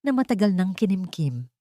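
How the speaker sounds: usual speaking voice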